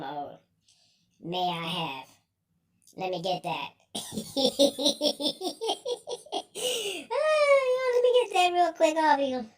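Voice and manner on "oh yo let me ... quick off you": high wail coming out of a laugh; laughing hard. Real, not acted. Repeating a cheeky request for food, laughing hard. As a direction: squeal it out, helpless with laughter. Cracking up at the phrase.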